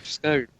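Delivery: "Scone" with a long oh, rhyming with bone; long, soft oh vowel in "Scone"; Queen's-English scone. Shown as a demonstration. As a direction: say "Scone" as skohn, quietly proud. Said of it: "I speak the Queen's language".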